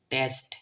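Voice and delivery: "টেস্ট" said loud with open eh; one emphatic "টেস্ট", vowel as eh. Shown as a demonstration. Teacher models a word for learners, "টেস্ট" with a clear eh. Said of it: "টিই উচ্চারণ হবে টে".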